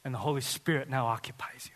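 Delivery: soft unvoiced whisper. Real, not acted. Pastor whispering assurance to listeners, hushed. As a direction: murmur it close and intimate.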